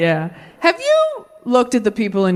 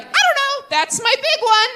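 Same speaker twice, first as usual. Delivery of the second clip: put-on much higher, naive childish voice; clueless kid self; 13 semitones higher than usual. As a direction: play it oblivious and proud. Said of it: "I just walked around all day like".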